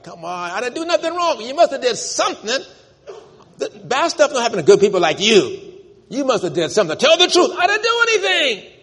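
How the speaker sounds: high, loud indignant protest; Job protesting to friends. Retold or imitated. Job pleading his innocence to accusing friends, indignant. Job's friends voiced in sermon.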